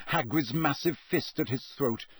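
ordinary delivery, nothing marked

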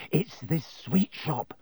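slow, dreamy voice. A narrator's reading. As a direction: linger over it longingly. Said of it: "a dreamy look coming over his face".

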